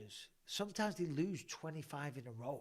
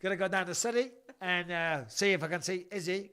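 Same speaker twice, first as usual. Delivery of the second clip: playful mock Kiwi accent, raised; New Zealand accent; 3 semitones higher, 5 dB louder than usual. Host asked for New Zealand, in a mock Kiwi accent. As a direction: ham up New Zealand vowels.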